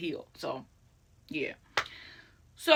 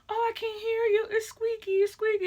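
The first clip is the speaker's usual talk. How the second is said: very high, squeaky mimicry; mock someone in a tiny high squeak; 13 semitones higher than usual. Charlotte mimicking Tiffany mid promo, high squeaky mockery. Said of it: "mimicking Tiffany's voice".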